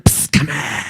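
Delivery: low hushed beckoning; sinister whisper call. Acted. Sinister whisperer behind a curtain.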